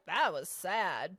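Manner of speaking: low, slow mock gloom; mock sad. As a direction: drag it out glumly. Retold past feeling.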